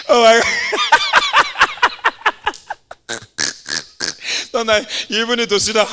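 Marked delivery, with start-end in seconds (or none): 0.5-4.5 squealing falling laugh; helpless high laughter; high shrieking laugh. Real, not acted. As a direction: let a shrill laugh fall away. Uncontrollable laughter.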